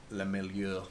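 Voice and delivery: Le Milieu in a put-on French accent. Shown as a demonstration. Streamer trying a French accent for viewers. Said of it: "Was that a good French accent?".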